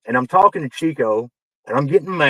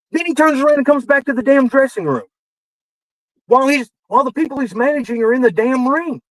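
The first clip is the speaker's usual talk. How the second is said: indignant rant, much higher pitched; real exasperation; 7 semitones higher than usual. Real, not acted. Wrestling writer griping about a do-nothing manager, exasperated. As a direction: complain in a high, incredulous voice.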